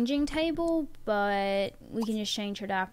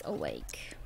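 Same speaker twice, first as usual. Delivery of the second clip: whispering; 10 dB quieter than usual. Real, not acted. Asking if boys woke.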